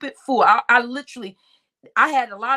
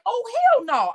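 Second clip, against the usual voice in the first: outraged disbelief; 12 semitones higher than usual. Retold or imitated. Retelling her reaction to her rival, high and outraged. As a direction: let it jump up in outrage.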